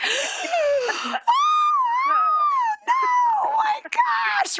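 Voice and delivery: high, loud gasping "oh no, my gosh"; a sudden high, loud shriek of dismay; shocked cry. Retold or imitated. Acted shocked reaction to God's answer.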